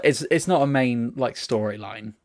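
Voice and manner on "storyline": ordinary delivery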